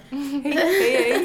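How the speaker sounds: slow, drawn-out mock hellos; awkward lost greeting. Wandering alone looking for her friends, awkward drawn-out hellos. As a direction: call out aimlessly, drawing out each hello.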